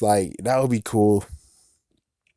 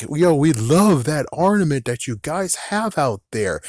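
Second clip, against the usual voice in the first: higher, lilting voice of an admiring judge; playful acted judge, pitched up; enthusiastic judge; 6 semitones higher than usual. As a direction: gush over it as a contest judge. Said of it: "make the judge just be like".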